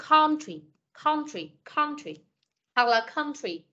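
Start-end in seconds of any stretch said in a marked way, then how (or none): 0.1-2.2 country drilled as "KON-tree"; "on" vowel. Shown as a demonstration. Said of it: "âm on". 3.2-3.6 country said as "KUN-tree", with a short schwa-like vowel. Shown as a demonstration. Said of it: "Khân âm ớ".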